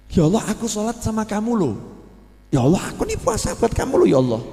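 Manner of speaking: whiny plea to God, building from plain to a loud, high outcry; a self-righteous believer protesting his deeds to God, growing indignant; complaining worshipper. Acted.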